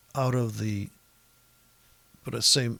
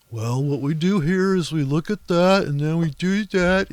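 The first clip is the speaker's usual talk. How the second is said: louder put-on textbook voice, parodied; mocking school-taught trader; 3 semitones higher, 6 dB louder than usual. Trader ranting at school-taught traders, louder mocking parody voice. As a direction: mimic a brainwashed analyst reciting the method. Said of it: "got brainwashed of this whole".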